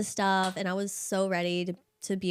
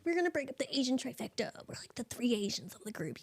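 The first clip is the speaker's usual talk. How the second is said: whispered plea; 5 semitones higher, 6 dB quieter than usual. Retold or imitated. Sad plea to departing friend.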